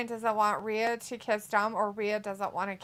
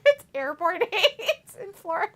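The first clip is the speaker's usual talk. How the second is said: high-pitched, breaking up with laughter; laughing; 8 semitones higher, 4 dB louder than usual. Real, not acted. Joking with viewers about Florida, laughing helplessly. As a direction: squeak it out through giggles. Cracks up at running joke.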